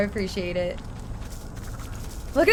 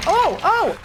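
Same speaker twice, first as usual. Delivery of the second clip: startled gasp; 5 semitones higher than usual. Real, not acted. Startled in a chase.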